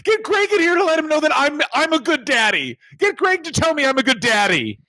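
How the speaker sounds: hammed-up, very high tearful pleading; mock-crying wrestler in a high voice; crying daddy's boy. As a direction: wail like an overgrown child begging for approval. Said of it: "crying all the time about their daddies".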